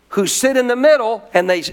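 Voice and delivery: ordinary delivery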